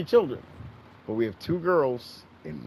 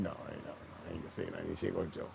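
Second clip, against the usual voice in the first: quiet son; 5 semitones lower, 12 dB quieter, much faster than usual. Retold or imitated. Quoting son.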